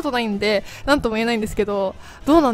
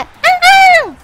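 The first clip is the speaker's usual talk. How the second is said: surprised yelp; 10 dB louder, much slower than usual. Real, not acted. Gasping during helium pull.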